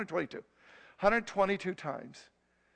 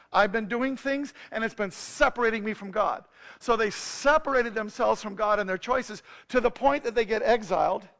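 louder, higher, driving sermon voice; preaching at full force; 4 semitones higher, 8 dB louder than usual. Acted. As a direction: preach it with rising fire. Preaching builds up.

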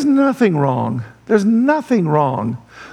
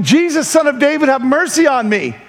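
higher-pitched retold cry for mercy; mild mock shout; 6 semitones higher than usual. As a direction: plead as if calling out, without full volume. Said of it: "He began shouting".

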